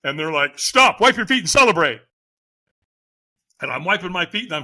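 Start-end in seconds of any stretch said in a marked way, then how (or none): 0.6-2.0 loud, raised-pitch order; called-out command. Retold or imitated. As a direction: call it out to a finishing walker. Catchers calling out at the end.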